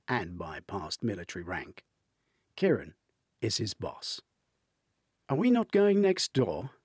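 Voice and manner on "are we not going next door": high, loud posh British query; posh British question. A narrator's reading. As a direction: ask it with a lifted British polish. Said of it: "Kieran asks".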